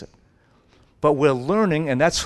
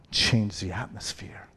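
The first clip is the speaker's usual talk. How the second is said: reverent whisper; 3 semitones lower, 4 dB quieter than usual. Real, not acted. Preacher drops to awed hush.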